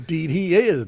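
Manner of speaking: high, loud fervent agreement; declaring. A narrator's reading. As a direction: affirm it like an old woman in church. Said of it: "the old woman declared".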